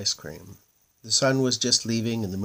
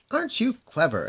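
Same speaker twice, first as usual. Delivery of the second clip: much higher admiring exclaim; 11 semitones higher, 4 dB louder than usual. Acted. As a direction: gush with amazement. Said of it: "exclaimed the moon people".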